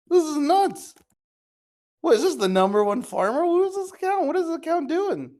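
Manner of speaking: high squeaky stunned whats; real shock at the number, voice jumping way up; can't believe what he's seeing; shocked disbelief. Real, not acted. Reveal of huge negative profit.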